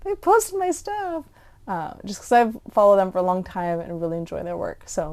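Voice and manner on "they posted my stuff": hushed, high-pitched mock squeal of delight; fangirl squeal. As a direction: gush like a starstruck fan, voice way up high. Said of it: "a little fangirl moment of".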